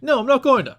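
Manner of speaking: high, loud hammed-up manager squeal; mock Jimmy Hart. Jimmy Hart refusing to reveal the limo, hammed-up high squeal. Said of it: "Jimmy's like".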